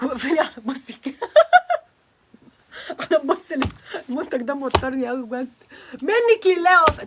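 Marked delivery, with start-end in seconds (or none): 1.2-1.8 high burst of nervous laughter. Real, not acted. 6.0-7.1 loud playful blame with a high start; mock curse.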